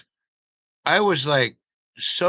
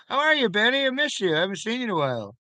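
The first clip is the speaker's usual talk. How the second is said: high silly greeting voice; 7 semitones higher, faster than usual. Pretend.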